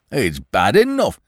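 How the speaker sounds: slow, put-upon policeman; harassed Sugg. A narrator's reading. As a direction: sound worn out by him. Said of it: "said the harassed Sugg".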